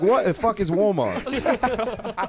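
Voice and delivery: high, drawn-out mock British accent; baffled Brit, accent hammed up through laughter. British kid asking what Walmart is, exaggerated British accent. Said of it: "this British kid".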